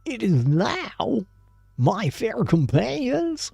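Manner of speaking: high, loud, slow grand proclamation; hammed-up solemn declaration, stretched out; mock-solemn accent. A narrator's reading. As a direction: declaim it loftily at full voice. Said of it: "said she with a solemn accent".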